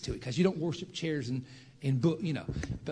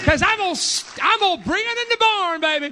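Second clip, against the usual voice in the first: much higher and louder, fiery preaching; preaching shout; 13 semitones higher, 11 dB louder than usual. Real, not acted. Preacher roaring at the congregation, full shout. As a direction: shout it to the back of the church.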